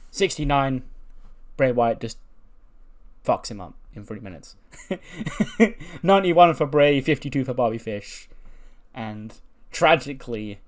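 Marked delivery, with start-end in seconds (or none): 4.7-6.0 high burst of laughter. Real, not acted. Laughing at the squash match.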